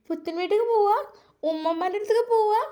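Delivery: put-on high, quick happy child voice; excited child. Children cheering on the ride to grandma's, excited. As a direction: bounce like a thrilled little kid.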